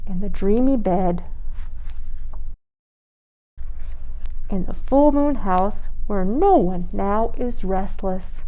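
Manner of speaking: slow, gentle storybook lull; hushed slow bedtime read; soothing bedtime reading. A narrator's reading. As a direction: ease them toward sleep.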